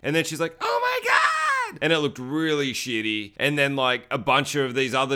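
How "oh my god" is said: very high, drawn-out mock shriek; mock horrified scream. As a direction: scream it in hammed-up horror. Acts out woman seeing partner killed.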